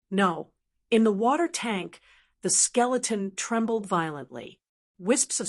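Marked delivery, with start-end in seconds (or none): none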